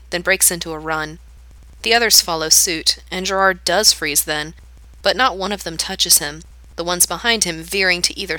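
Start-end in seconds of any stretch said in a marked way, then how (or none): none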